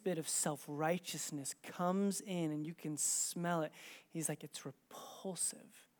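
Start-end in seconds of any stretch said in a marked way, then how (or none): 4.4-5.9 low, slow whisper; hushed verdict. Retold or imitated. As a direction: breathe the judgement out very softly. Holy Spirit's verdict on self-righteousness.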